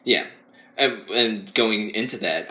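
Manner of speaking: normal talking voice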